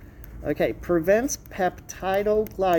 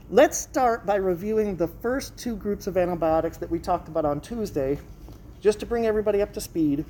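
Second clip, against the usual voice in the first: ordinary delivery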